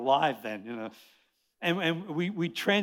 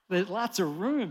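usual speaking voice

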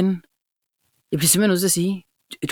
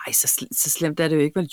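softer, kind mollifying; soft sweet reassurance; sweet soothing; 6 semitones lower, 6 dB quieter than usual. Retold or imitated. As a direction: soothe gently. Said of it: "meget sød og sagde".

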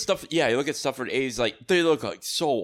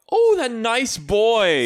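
slow, much higher and louder gush; hammed-up doting mom, drawn out; mock old-lady swoon over a suitor; gushing mother; 11 semitones higher, 7 dB louder, much slower than usual. Voicing Mary's mom gushing.

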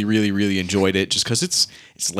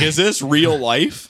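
stunned disbelief; 6 semitones higher, much slower than usual. Retold or imitated. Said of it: "I was like".

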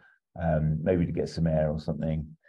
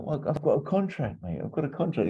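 put-on higher, cheeky English accent; 10 semitones higher than usual. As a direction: say it like a London geezer. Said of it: "He's being proper English as well".

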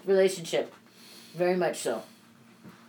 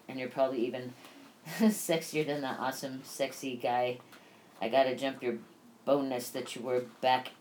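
soft, subdued reading of a grief diary; reading a grief journal; 7 semitones lower, 9 dB quieter than usual. Real, not acted. Widow reading her journal about Byron's death, soft and sad. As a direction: read your own diary entry, holding back tears.

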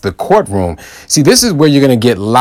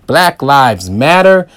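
usual speaking voice

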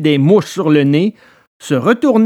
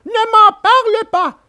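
much higher, louder scared puppet cry; frightened cry; 4 dB louder than usual. A narrator's reading. Pinocchio interrupting Alidor, high frightened cry. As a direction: cut him off, still shaking with fear. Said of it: "cria Pinocchio qui tremblait encore de peur".

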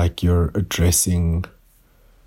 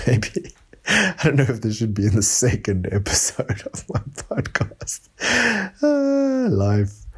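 higher-pitched, talking through real laughter; laughing; 7 semitones higher than usual. Real, not acted. Podcaster doubting the recording belongs in the episode, laughing. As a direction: crack up while getting the words out.

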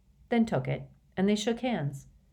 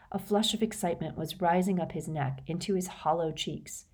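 plain reading voice

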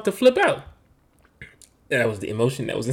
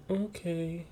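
put-on soft, slightly higher child's answer; son's meek reply; 4 semitones higher, 11 dB quieter than usual. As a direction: answer small, like a chastened kid. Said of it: "And he's like".

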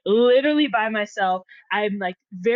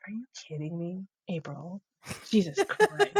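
much softer, fed-up mother muttering her daughter's name; exasperated mom; 3 semitones lower, 7 dB quieter than usual. Retold or imitated. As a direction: mom at wit's end, half under her breath. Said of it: "she sees it and she's like".

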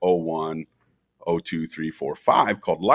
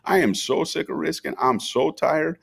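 high-pitched protest, climbing at the end; fed-up, emphatic imitation of himself; exasperated refusal; 9 semitones higher than usual. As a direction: plead to be let off, retold. Recounts pleading refusal to friend.

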